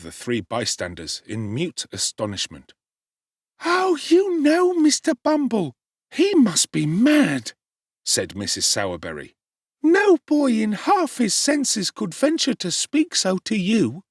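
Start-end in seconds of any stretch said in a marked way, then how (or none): 3.6-7.5 high, slow shocked woman's voice; a fussy wife aghast; alarmed matron. A narrator's reading. Mrs Sowerberry to Bumble about Oliver, shocked and high. 9.8-14.0 high, slow shocked woman's voice; a fussy wife aghast; alarmed matron. A narrator's reading. Mrs Sowerberry to Bumble about Oliver, shocked and high.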